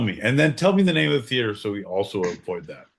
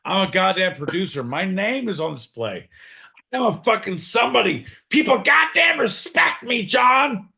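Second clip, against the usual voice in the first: high, hammed-up outrage building to a shout; indignant producer; 7 semitones higher than usual. Producer demanding respect from John, mock fury building. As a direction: rant with rising self-importance. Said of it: "I am the fucking producer".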